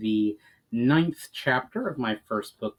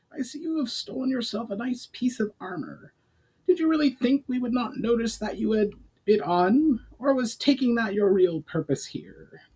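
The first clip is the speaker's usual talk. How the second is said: high accusing goblin voice; a goblin calling out a thief; 12 semitones higher than usual. A narrator's reading.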